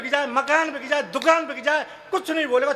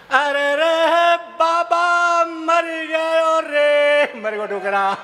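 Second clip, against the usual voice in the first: slow, loud, stretched lament; hammed-up mourning wail over the old man; mock wailing; 4 semitones higher, 4 dB louder, much slower than usual. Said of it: "देखें आप". (नाटकीय रोना-धोना)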